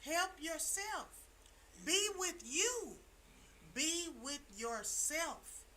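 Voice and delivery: slow preaching cadence, each phrase weighted; slow, deliberate advice. Real, not acted. Urging listeners to be with themselves, slow and deliberate. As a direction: lay each phrase down slowly.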